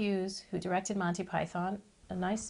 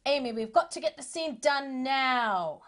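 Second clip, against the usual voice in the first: higher, louder, slow mock director's yell; impatient yell; 6 semitones higher, 4 dB louder than usual. Director yelling at her to cry, mock yell. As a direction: bark the order at a stalled child actor. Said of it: "He yelled at me".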